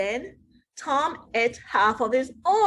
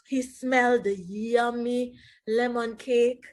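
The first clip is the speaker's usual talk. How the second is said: plain reading voice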